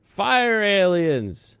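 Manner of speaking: slow, high, loud announcement; drawn-out hype shout. Acted. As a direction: stretch it like a game-show host. Hyped combined topic announcement.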